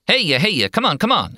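high, rapid auctioneer chant; auctioneer patter. A narrator's reading. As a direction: rattle it off like a cattle auctioneer. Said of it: "he says, high fast".

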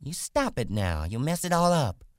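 high, loud woman's scolding, rising in pitch; angry cry. A narrator's reading. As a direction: scold him sharply and angrily. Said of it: "she cried angrily".

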